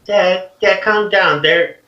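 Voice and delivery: daughter's voice. Acted. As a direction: step in to calm your angry father. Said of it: "she pipes up and is like".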